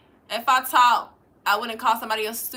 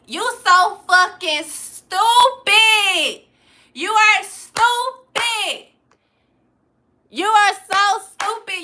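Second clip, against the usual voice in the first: higher, louder, very slow jeer; loud mocking taunt; 8 semitones higher, 6 dB louder, much slower than usual. Real, not acted. Jeering at Dustin for trolling her, loud and drawn out. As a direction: belt the insult out, dragging every word.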